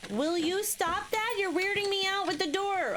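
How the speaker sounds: high-pitched, fed-up scolding; exasperated. Real, not acted. Annoyed at game's door.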